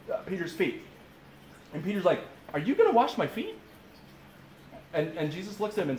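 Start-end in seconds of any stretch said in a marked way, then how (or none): 2.5-3.6 high, astonished question, voice leaping up; incredulous question. Acted. Disciple to Jesus as he starts washing, incredulous. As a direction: act out a disciple's disbelief, pitched way up.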